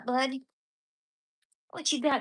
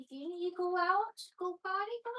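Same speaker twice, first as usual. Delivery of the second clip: pet talk; 8 semitones higher than usual. Real, not acted. Owner coaxes his dog to go potty in baby talk. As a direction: sweet-talk the puppy outside.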